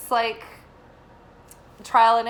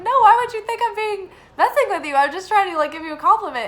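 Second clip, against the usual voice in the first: insistent protest; 8 semitones higher, 6 dB louder, faster than usual. Retold or imitated. Protesting to suspicious producer.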